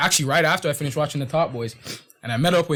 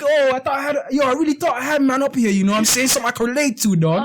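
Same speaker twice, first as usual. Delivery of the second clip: higher, mock-disappointed London accent; hammed-up dejected London roadman; sad London man; 9 semitones higher than usual. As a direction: imitate his let-down in a higher voice. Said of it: "The man was sad".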